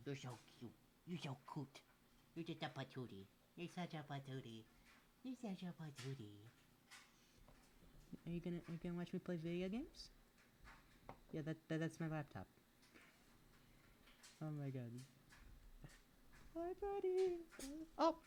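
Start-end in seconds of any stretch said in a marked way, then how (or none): 0.1-6.4 softer, fond murmur; quiet fond pet talk; tender cooing. Real, not acted. Soft cooing to cat. 16.6-18.2 very high squeaky greeting; high cooing greeting. Real, not acted. Greeting cat.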